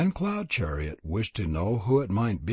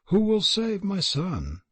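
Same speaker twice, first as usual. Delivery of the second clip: higher, anguished cry of alas; mother crying out; 7 semitones higher than usual. Acted. Old mother cries out for her doomed son, higher and anguished. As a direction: plead desperately to the sky. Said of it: "And the mother cried".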